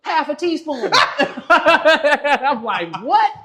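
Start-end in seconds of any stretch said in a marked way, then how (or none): 0.0-0.9 friend's voice, pitched higher, half laughing; a higher, amused voice for the friend; lightly voiced dealer, laughing at him. Voicing the dealer scolding him. 0.9-1.4 loud, high burst of laughter; high-pitched cackle of disbelief; big laugh. Real, not acted. Laughing at the overdose story. 3.0-3.3 much higher and louder, laughing disbelief; shocked what. Retold or imitated. Shocked reaction to the dose.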